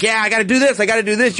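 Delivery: a touch of hyped-up resolve; fired-up. Retold or imitated. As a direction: sound swept up by a pitch. Mimics fired-up listener.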